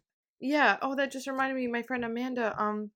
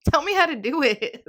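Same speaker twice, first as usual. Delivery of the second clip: loud, high exasperated plea; mock exasperated demand; 7 semitones higher, 13 dB louder than usual. Confused beginner begging tutorial makers, exasperated. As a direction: burst out loudly, playfully fed up.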